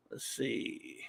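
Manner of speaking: whispered to himself while looking. Real, not acted. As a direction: breathe it under your breath. Scanning the chat.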